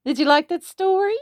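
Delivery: high excited ask; eager question. Real, not acted. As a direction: pitch up, bright and eager. Asking child eagerly, high.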